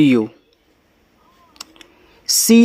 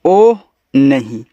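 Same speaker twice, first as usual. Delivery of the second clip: slow demo; 4 semitones lower, slower than usual. Shown as a demonstration. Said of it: "इसका मतलब होता है".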